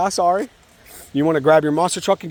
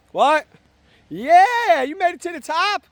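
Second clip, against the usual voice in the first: delighted dad voice; excited praise; slower than usual. Real, not acted. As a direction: cheer him on, way up high. Dad praises child reaching top.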